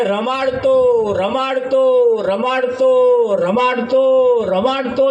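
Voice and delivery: slow, stretched repeats of રમાડતો; drawn out. Acted. Guru telling the greedy man, each રમાડતો stretched slow. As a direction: draw each રમાડતો out slowly, with slowly rising weight. Guru stresses coddling the fruit.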